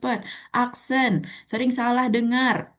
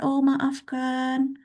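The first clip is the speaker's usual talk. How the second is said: slowed apology; 4 semitones higher, much slower than usual. Real, not acted. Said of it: "Bicaranya terlalu cepat".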